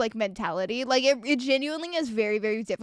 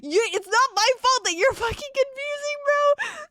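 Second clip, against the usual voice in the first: much higher, half-crying whine; outraged whine; 11 semitones higher than usual. Real, not acted. Streamer venting at the confusing customer, exasperated wail. Climax of rant.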